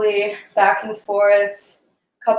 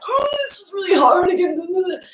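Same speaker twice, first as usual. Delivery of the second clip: high, strained whine of a struggling student; 10 semitones higher than usual. Retold or imitated. Student straining in a tight pose, high whine. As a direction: whine like it's much too hard. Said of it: "they're just, like, holding their breath".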